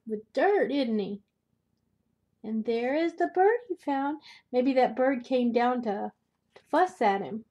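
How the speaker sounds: ordinary delivery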